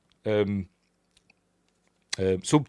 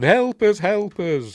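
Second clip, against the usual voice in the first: high, raised-voice plea; mock cry for help; 10 semitones higher, 6 dB louder, slower than usual. Retelling her radio call for help, mock distress. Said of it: "so she's calling for help".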